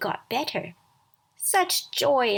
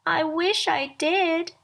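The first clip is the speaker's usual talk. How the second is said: higher, slow, wistful little rabbit voice; a small toy rabbit sighing a wish; 7 semitones higher, 4 dB louder, slower than usual. A narrator's reading. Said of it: "said Velveteen Rabbit".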